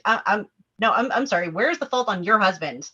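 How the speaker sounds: ordinary delivery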